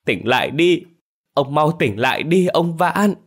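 high-pitched lament for the dead; anguished wailing. A narrator's reading. Second wife running to her dead husband, anguished wailing. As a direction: cry out in pain, drawing out each call. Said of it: "vừa chạy vừa gào lên đau đớn".

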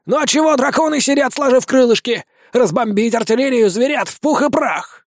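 indignant vampire. A narrator's reading. Vampire urges the dragons to bomb, high and impatient. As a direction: press for it impatiently.

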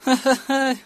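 high gloating cackle; gloating laugh. Acted. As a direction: laugh at their defeat. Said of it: "ضحك في شماتة".

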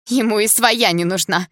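high, loud interjection; bitter outburst. A narrator's reading. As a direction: throw it out suddenly, hurt. Girl bitterly interrupts about her stepfather.

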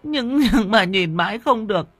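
high, tearful, stuttering account; sobbing confession. A narrator's reading. Woman telling what the children plotted, sobbing confession. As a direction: confess through sobs, catching on your words. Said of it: "Trong cơn nức nở, mụ Vi kể lại".

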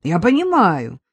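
ordinary delivery

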